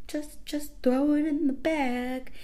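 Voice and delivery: mock the parent barking an order. Retelling a pushy parent ordering her to throw candy in, lightly voiced and slow. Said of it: "barking at me".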